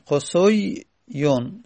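قصویون drawn out slowly, sound by sound, rising at the end; slow spelled-out word. Shown as a demonstration. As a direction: stretch out قصویون slowly for students. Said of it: "slow drilled repeat of the form". (قصویون را آهسته و کشیده، هجا به هجا بگو)

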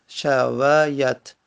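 very slow, syllable by syllable; شمرده و هجاهجا. Shown as a demonstration. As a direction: stretch out each syllable of شویت for the class. Teacher repeats form syllable by syllable.

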